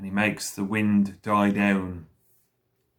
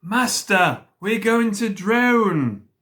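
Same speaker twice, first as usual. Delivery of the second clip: high, loud, drawn-out wail; storybook wail of alarm; frightened cry; 11 semitones higher, 5 dB louder, slower than usual. A narrator's reading. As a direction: cry out in exaggerated panic, stretching the words. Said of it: "and cried".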